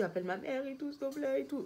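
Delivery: high, pleading retold plea, a mild hint of tears; begging her husband to call her mother; pleading, near tears. Wife begging husband to call her mom, high and pleading. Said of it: "Ah, j'étais en chiale".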